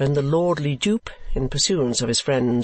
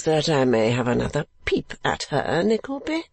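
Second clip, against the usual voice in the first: foolish lordly dupe; 4 semitones higher than usual. Acted.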